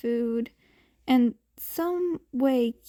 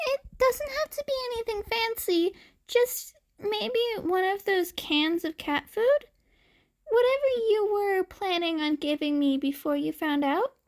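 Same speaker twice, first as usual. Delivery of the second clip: put-on higher, fast, forced hopeful kid voice; forcibly hopeful; 8 semitones higher, faster than usual. Hungry boy begs the man for cat food, forcedly hopeful. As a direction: push the hope a bit too hard. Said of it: "he asked with a forcibly hopeful tone".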